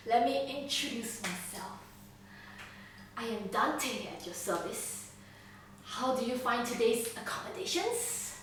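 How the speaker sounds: soft, unhurried, mock-courteous host; Dante. Captor introduces himself to prisoner, softly mock-courteous. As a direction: purr politely, with questioning lilts. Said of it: "And he says".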